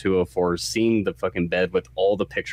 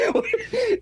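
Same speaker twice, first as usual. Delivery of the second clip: high laugh. Real, not acted. Laughing after quote.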